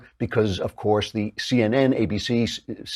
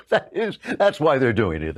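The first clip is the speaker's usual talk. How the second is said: ordinary talk, nothing marked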